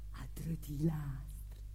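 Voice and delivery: slow, low near-whisper; very soft, drawn-out and low; hushed storytelling. Acted. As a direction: let it sink into a slow whisper. Dialect storyteller hushes the scene.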